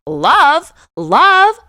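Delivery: high, loud gushing repeat; delighted. Real, not acted. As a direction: savour the good news, squealing a little. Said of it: "I was so happy".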